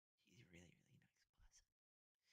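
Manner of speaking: soft unvoiced whisper. Real, not acted. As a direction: whisper it tenderly, almost to yourself. Tender aside about Kerry.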